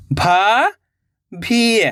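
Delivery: भाभी drawn out very slowly, each sound held. Shown as a demonstration. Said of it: "तो मिलके बन जाएगा". (भाभी को बहुत धीरे खींचकर बोलें)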